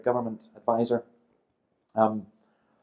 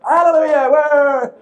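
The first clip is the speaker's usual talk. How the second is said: high, loud mock congregation cheer; imitating ecstatic churchgoers, at full volume; jubilant Pentecostal cheer; 14 dB louder, slower than usual. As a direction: whoop it up like an elated congregation. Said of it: "all the Pentecostals went".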